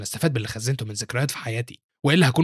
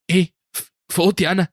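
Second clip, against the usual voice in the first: startled stammer, pitched higher; 6 semitones higher, 4 dB louder than usual. A narrator's reading. Accused pupil answers his master, startled. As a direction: blurt it out in stammering surprise. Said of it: "بيتفاجئ هو يونج وبيقول له".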